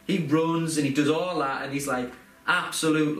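normal talking voice

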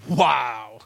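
low, drawn-out dubious wow; acted wary, deadpan wow; unimpressed wow. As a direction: react as if put off. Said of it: "you don't know if they're like".

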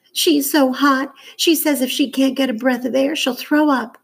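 put-on high, worried girl's voice; upset girl, pleading. A narrator's reading. Scylla tells Johnny the little girl is too hot, high and upset. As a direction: play a distressed girl reporting on her sick sister.

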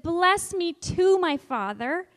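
loud, slow plea on too; teacher voicing Esau begging his father; pleading cry. Shown as a demonstration. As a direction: stretch out the plea. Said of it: "Esau said".

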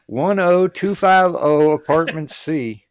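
slow, loud, spelled-out numbers; slow formal address. Retold or imitated. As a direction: read each digit out slowly. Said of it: "you gotta go".

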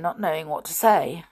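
ordinary delivery

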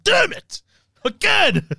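frustrated yell. Retold or imitated. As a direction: curse the near miss. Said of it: "Death's like".

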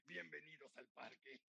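raspy, slow clown voice with giggles. Shown as a demonstration. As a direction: welcome the park crowd as a clown. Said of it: "esa era mi voz de payaso".

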